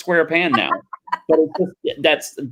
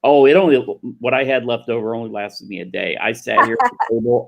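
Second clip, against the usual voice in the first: usual speaking voice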